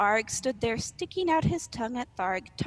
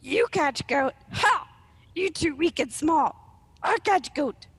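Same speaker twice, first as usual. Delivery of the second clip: loud, raised, mocking troll voice; scoffing troll; 5 semitones higher, 4 dB louder than usual. Arg mocks the smaller troll, loud scoffing troll voice. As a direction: scoff at a puny rival troll.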